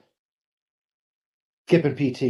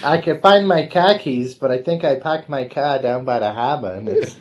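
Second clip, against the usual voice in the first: hammed-up Boston accent, slowing into a laugh on gone; 2 semitones higher than usual. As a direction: play the Boston guy who lost his car. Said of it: "impression of a Boston accent".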